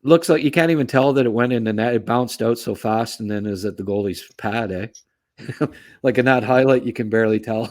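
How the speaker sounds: normal talking voice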